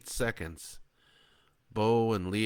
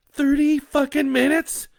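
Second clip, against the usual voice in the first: high, loud disbelieving cry; horrified; 13 dB louder than usual. Acted. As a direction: Leah hearing thirty minutes, a high loud horrified cry. Leah horrified.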